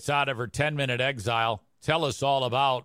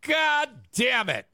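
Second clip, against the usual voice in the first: mock angry rant; 8 semitones higher than usual. Hammed-up angry host rant.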